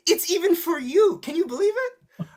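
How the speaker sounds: high-pitched playful disbelief; mock amazement. Feigned amazement, teasing non-participants.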